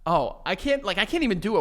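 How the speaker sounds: ordinary talk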